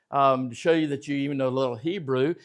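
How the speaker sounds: ordinary delivery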